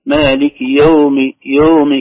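"يوم" as "yoomi", no open a; slow recitation, round "يوم" into a long o; long-vowel waw. Retold or imitated. Teacher imitating the common error, "yoomi" for "yawmi". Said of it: "كأنها يوم وليست يوم".

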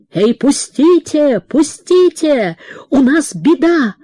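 high, slow calls through a door; calling out urgently. A narrator's reading. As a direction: shout to be let in.

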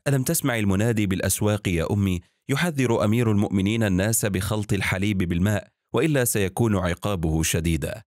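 usual speaking voice

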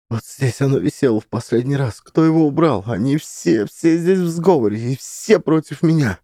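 high, tense suspicious voice; paranoid woman. Acted. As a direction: voice her rising suspicion.

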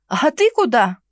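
loud startled question; exclaiming. A narrator's reading. Girl catching Henrich leaving, exclaiming. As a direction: call it out after him. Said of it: "воскликнула".

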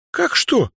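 high, astonished question; high-pitched girlish surprise; surprised. A narrator's reading. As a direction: react as if it were obvious, pitch shooting up. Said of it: "удивилась Дроу".

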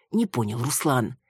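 plain reading voice

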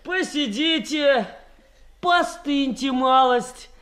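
high, drawn-out mocking call; taunting. Gang leader taunting locked-up captives, high drawn-out call. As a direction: stretch it out to taunt them.

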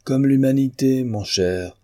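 a touch of sneering amusement, opening high; nasty mocking laugh. A narrator's reading. Painter answering his shocked cousin, mildly sneering. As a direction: toss it back with mild mockery. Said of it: "riant d'un mauvais rire".

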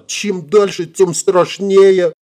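high, stretched-out wail; acted tearful fretting; nearly crying. A narrator's reading. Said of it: "чуть не заплакала она".

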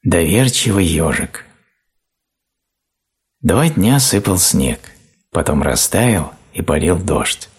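ordinary delivery

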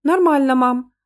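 put-on high little-girl voice; child calling back, pitched high; reassuring child. A narrator's reading. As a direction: pipe up brightly like a small daughter. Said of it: "подала голос дочь".

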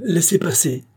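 shouted order. Acted. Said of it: "cria l'homme".

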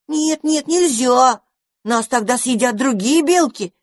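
high frightened squeal; scared little squirrels protesting. A narrator's reading. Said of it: "испугались бельчата".

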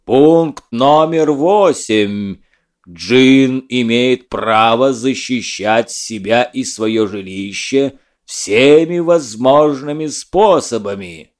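booming, slow voice from the heavens; echoing ethereal energy being. Acted. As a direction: roar the rule like a thundering spirit. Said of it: "Он громогласно ревел".

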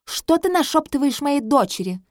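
high, loud indignant cry; indignant queen. Acted. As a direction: snap at him in outrage. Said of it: "возмущается королева Патриция".

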